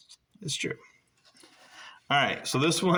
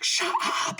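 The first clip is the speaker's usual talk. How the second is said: disbelief. Retold or imitated. Friends reacting in disbelief.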